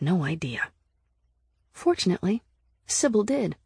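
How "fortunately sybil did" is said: usual speaking voice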